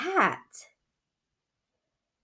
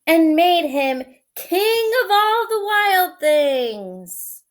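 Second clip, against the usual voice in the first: story reading; 8 semitones higher, 7 dB louder than usual. A narrator's reading. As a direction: read it like a bedtime story. Reads picture book aloud.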